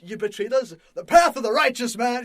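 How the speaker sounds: loud, high, ranting action-hero delivery; hammed-up righteous accusation; Samuel Jackson. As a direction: thunder it out like a mock movie tirade. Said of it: "just different than Samuel Jackson".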